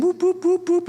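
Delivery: high imitated party whoops; celebratory whooping. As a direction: whoop along like a wedding procession. Imitates procession whooping through town.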